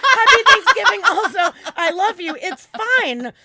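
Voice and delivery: high, loud, laughing through the shock; frazzled laughing reply. Retold or imitated. Answering Andy's holiday greeting while still shaken, loud and laughing. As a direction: gush it out, still rattled.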